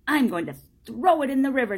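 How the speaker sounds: fast, loud, high angry outburst; defiant boy. Acted. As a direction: blurt it out in hot defiance. Angry boy answers the man.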